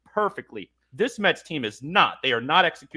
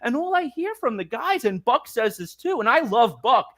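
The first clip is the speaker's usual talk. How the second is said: ordinary talk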